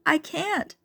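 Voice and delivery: high, anxious protest; stalling. A narrator's reading. Charlene stalling at the street, high anxious protest. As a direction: hold back in fear. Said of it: "in effort to stall".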